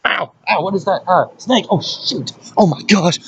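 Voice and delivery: high, real startle at a snake; real surprise, high and quick; startled. Real, not acted. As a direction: yelps of sudden alarm.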